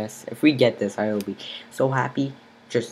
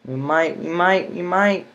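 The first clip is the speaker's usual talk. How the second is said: we might, each time higher and louder; building hope, higher and louder on each we might; rising excitement; 7 semitones higher, 7 dB louder than usual. Real, not acted.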